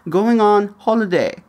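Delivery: lightly mimicked British kid; British-sounding child. Quoting a seven-year-old's Peppa Pig English in a light British accent. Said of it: "seven-year-old son refers to vacation".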